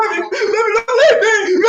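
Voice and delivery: high, whiny fake sobbing plea through laughter; mock him begging her not to leave; hammed-up crybaby boyfriend; mocking crying boyfriend. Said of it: "he's like".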